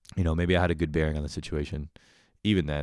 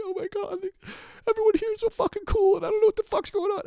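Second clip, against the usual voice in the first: very high, squeaky panicked voice; high-pitched mock panic at the party; nervous inner voice. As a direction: squeak it like an overwhelmed kid. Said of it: "I was just, like, tweaking out".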